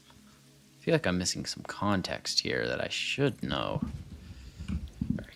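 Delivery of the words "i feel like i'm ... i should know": usual speaking voice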